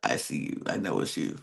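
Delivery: hint darkly that you know the truth; low knowing hint. Retold or imitated. Roxy quietly signalling to James she suspects him, low and knowing. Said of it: "basically saying".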